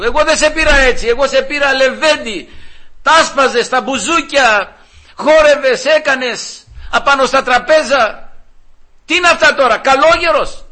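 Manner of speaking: shrill-pitched mock outrage from the wife; indignant wife. Wife scolds her converted husband.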